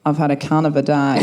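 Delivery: low, broad Australian accent; Australian, with wide vowels on "day". Shown as a demonstration. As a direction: drop it low in an Aussie twang. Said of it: "in my original Australian accent".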